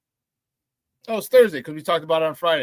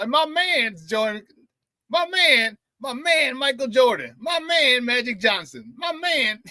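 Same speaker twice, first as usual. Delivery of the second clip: hammed-up, higher sportscaster voice repeating "my man"; Ahmad Rashad impression; 6 semitones higher, slower than usual. Host imitating Ahmad Rashad on NBA on NBC, hammed-up higher voice. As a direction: play the smooth TV sportscaster introducing every star as your man. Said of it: "you know who I sound like? I sound like Ahmad Rashad".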